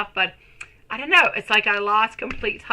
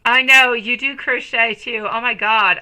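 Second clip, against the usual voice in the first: ordinary talk, nothing marked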